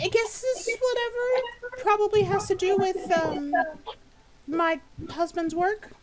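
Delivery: a nervous hostess piping up; Myrtle's high voice. Acted. Game master voices Myrtle asking why the party came, high. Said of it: "And she's like".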